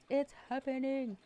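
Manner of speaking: quoting The Office. Retold or imitated. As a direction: chirp the catchphrase up high. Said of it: "that saying from The Office".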